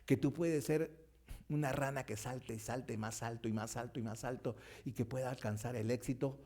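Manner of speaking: usual speaking voice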